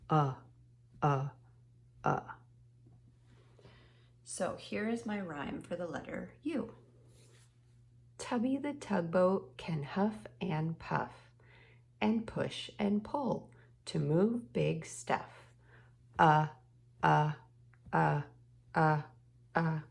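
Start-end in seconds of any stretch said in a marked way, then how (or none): 0.1-2.2 repeated short "uh" punched from the chest; short u sound. Shown as a demonstration. Teacher shows the class the u sound, punched. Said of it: "touch our chest and say". 16.2-19.8 "uh" chugged loud like a tugboat engine; tugboat chugging u. Shown as a demonstration. Teacher chants the tugboat sound for the class, chugging. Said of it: "That's the sound of Tubby the Tug".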